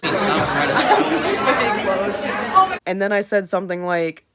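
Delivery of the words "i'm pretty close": slow, stretched I'm pretty close; drawn-out repeat. Retold or imitated. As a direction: drag out pretty close teasingly.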